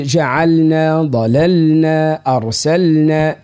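very slow words, each L held before N; clear lam before nun. Shown as a demonstration. Teacher giving similar examples, lam held before nun. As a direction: stretch each L, then release into N. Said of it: "قس على ذلك".

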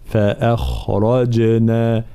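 "فأخرجنا" with a thin hamza, heavy خ and ر, and a light, bouncing ج; very slow, every letter shaped; tajweed demo. Shown as a demonstration. Teacher modeling each letter of the word for students, very slow. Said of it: "الجيم مرققة ومقلقلة".

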